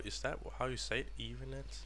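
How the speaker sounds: usual speaking voice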